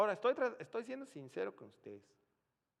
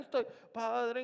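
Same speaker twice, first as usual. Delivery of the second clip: fervent prayer; 8 semitones higher, 9 dB louder than usual. Retold or imitated.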